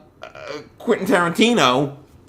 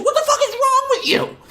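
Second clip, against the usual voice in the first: high, laughing mock scream of rage; retold anger scream. Retelling the party outburst at Megan, a laughing high shriek. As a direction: shriek it through a laugh, half-joking. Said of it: "it was like an anger scream".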